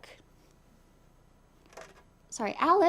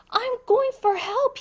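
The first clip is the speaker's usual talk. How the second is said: much higher, louder frightened call; panicked call; 12 semitones higher, 4 dB louder than usual. Acted. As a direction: yell it while running off in a fright. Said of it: "he called over his shoulder as he ran out".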